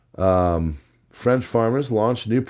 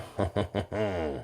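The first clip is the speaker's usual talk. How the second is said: amused chuckle; 11 dB quieter than usual. Real, not acted. Host amused by the next French story, soft chuckle. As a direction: chuckle quietly to yourself.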